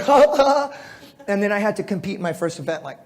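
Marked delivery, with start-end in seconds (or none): 0.0-0.8 dazed giggle, laughing high and loud; concussed giggling. Retold or imitated. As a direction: giggle like someone just knocked out.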